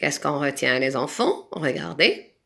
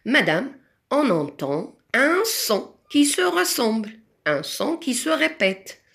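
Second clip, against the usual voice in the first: imitating a pupil; 6 semitones higher than usual. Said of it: "Vous allez me dire".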